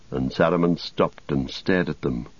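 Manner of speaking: usual speaking voice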